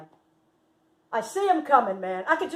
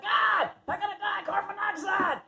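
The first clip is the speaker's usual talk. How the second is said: high-pitched panicked cry; mock panic; 10 semitones higher than usual. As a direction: re-enact a hammed-up fear of suffocating. Reenacts herself choking in smoky room.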